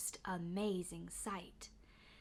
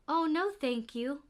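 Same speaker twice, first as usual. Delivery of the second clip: lightly voiced girl; 5 semitones higher than usual. A narrator's reading. Girl answers saleslady.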